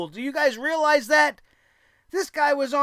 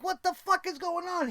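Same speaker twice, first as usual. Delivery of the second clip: high, softer mock-baffled question; imitated bewilderment; 6 semitones higher, 6 dB quieter than usual. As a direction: lightly mimic a baffled woman. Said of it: "Alexa Bliss is like".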